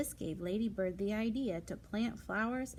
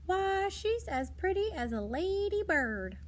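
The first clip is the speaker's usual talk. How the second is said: high, louder cooing nurse; doting nurse; 10 semitones higher, 5 dB louder than usual. Acted. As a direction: coo it like a charmed nurse over a baby. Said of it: "A nurse said".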